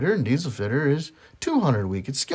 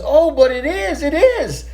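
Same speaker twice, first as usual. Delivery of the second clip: put-on insistent kid voice; 13 semitones higher, 6 dB louder, slower than usual. Boy insists to mother.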